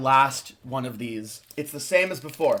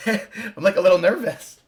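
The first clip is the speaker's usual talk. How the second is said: giggly, higher-pitched admission of nerves; nervous laughing; 6 semitones higher, 4 dB louder than usual. Real, not acted. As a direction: laugh nervously through the words. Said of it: "I don't know why I'm nervous".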